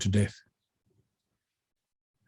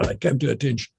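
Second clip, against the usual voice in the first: normal talking voice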